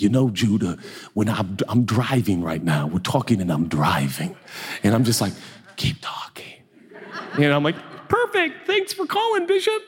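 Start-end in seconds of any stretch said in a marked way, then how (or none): 0.0-4.4 lightly voiced low, weighty elder preacher; Bishop Jakes. Bishop chats to preacher while driving, lightly imitated low voice. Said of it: "if you know Bishop Jakes, he's like". 5.8-6.6 hushed, whispered urging; awed whisper. Acted. Rapt preacher to bishop on the phone, whispering. 8.1-9.8 hammed-up squeaky-high fanboy; starstruck high voice. Nervous fan thanks bishop for calling, mock high voice. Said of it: "that's how I feel like I sound".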